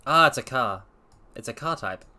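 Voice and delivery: ordinary delivery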